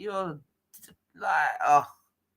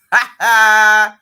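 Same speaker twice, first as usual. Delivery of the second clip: loud mocking laugh; 12 dB louder than usual. Real, not acted. Host laughing loudly at the caller's impatience. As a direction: burst of loud scornful laughter.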